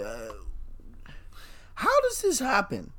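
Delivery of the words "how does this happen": very high disbelief; baffled squeak. Real, not acted. Confused about the card fusion, squeaking disbelief. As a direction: crack up into a confused question.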